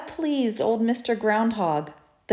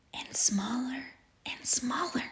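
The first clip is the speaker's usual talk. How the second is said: fading whisper; 3 semitones higher, 10 dB quieter than usual. A narrator's reading. Narrator whispering as the wings vanish. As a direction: whisper it away to nothing.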